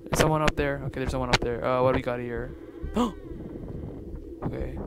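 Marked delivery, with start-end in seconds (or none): 2.6-4.4 high, breathy gasp; startled gasp. Real, not acted. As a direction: suck in air in fright. Startled in horror game.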